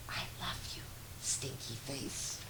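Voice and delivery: breathy, voiceless whisper. Acted. Mama whispering love into her child's ear at bedtime. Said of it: "and whisper in your ear".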